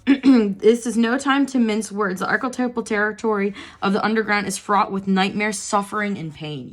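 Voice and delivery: reading aloud. Acted. Reading the card's guidebook text to viewers, measured reading voice. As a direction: read the guidebook passage evenly. Reads underlined passage.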